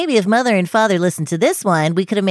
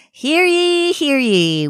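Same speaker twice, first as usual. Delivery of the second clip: town crier; 6 semitones higher, much slower than usual. Acted. Reading grievances like a proclamation.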